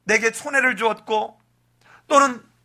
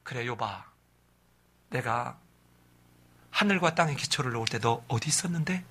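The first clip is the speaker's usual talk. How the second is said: much lower and softer, a solemn deep God voice; 10 semitones lower, 9 dB quieter than usual. Retold or imitated. Preacher voicing God questioning Job, low and soft. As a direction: let God speak low and hushed. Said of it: "하나님이 등장하죠".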